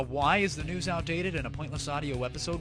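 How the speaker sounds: normal talking voice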